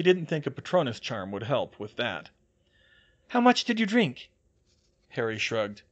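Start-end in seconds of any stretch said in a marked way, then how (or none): 3.3-4.3 high, loud question; concerned high-pitched query; Hermione's voice. A narrator's reading. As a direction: press for an answer in a raised character voice. Hermione questions Harry, voiced by narrator.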